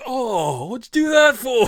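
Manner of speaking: outraged after being headbutted; indignant yelp. Acted. GM voicing the headbutted friend protesting, loud indignant yelp. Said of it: "He says".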